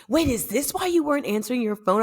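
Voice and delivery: high-pitched dawning realization; amazed parents. Retold or imitated. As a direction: ask it up high, imitating astonished parents. Parents' amazed question, retold.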